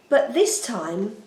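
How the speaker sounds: ordinary delivery, nothing marked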